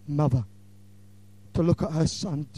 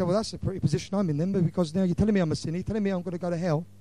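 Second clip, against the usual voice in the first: fast mock protest; faster than usual. Imagined listener's hurried protest.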